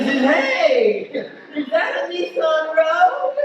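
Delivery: high, drawn-out call; mock calling out. Comic replaying his question to the Porsche owner, mock calling out. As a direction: exaggerate the friendly shout, high and slow.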